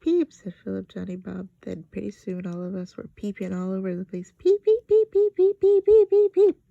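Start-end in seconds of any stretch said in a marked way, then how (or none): 0.0-0.3 high, loud squeaky peep; chick peep. A narrator's reading. Philip joins the peeping with a squeaky chick peep. 4.4-6.6 very high, loud chick peeps; wild peeping. A narrator's reading. All the kids peep wildly like chicks.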